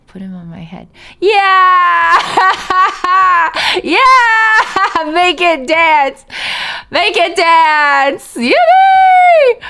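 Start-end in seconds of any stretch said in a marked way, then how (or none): 1.2-9.6 high, loud squealing cheers with laughter; excited cheering. Real, not acted. Streamer squealing at the dancing alert, shrieking with delight.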